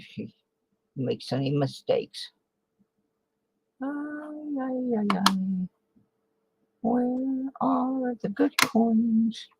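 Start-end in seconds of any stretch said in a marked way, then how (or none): 3.8-5.7 high playful cry. Pretend. 6.8-9.5 high slow whine. Pretend.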